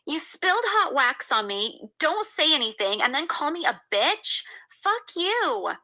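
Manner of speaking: high, furious retort; angry yell. A narrator's reading. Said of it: "I yelled in her face".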